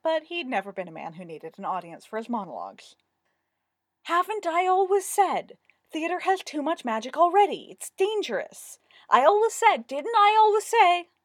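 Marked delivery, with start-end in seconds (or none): 4.0-11.1 high, loud ranting monologue; indignant demand. A narrator's reading. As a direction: rant as if vindicated, pitched way up. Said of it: "he demanded".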